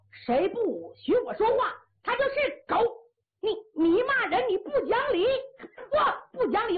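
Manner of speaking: high, heated back-and-forth; angry quarrel. Acted. Chess player and Hu Dahai threatening blows, high and angry. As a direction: argue heatedly with pitch pushed up. Quarrel heads toward a fight.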